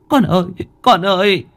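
put-on high tearful cry to her child; mother crying out. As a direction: call for the lost child through tears. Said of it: "nước mắt như mưa".

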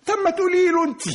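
high-pitched indignant outburst; indignant retort. Acted. Brother cornered in questioning turns on his sister, indignant. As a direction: throw it back at your sister, voice leaping up.

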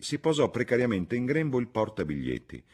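plain reading voice

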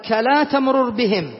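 slow, careful quote of "كلا تمرر بهم"; slow verse quote. Shown as a demonstration. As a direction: recite the verse words slowly. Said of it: "قال". (تلاوة بطيئة لـ"كلا تمرر بهم")

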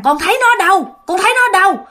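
high, pressing father's question; urgent questioning. Retold or imitated. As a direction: press the child for an answer. Startled father questions his child.

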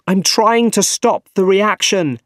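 high, loud reply over the chaos. A narrator's reading. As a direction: call back urgently in mid-struggle. Urgent answer amid reactor disaster.

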